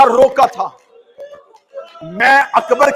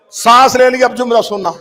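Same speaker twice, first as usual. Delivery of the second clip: ordinary delivery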